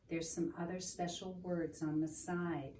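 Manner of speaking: ordinary delivery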